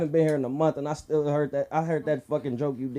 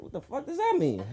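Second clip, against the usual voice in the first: mock bafflement; 5 dB quieter than usual. Retelling his own confusion.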